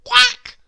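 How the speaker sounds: loud, high quack; duck quack. Acted. Ducks in the farmyard tangle, quacking. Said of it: "quacked the ducks".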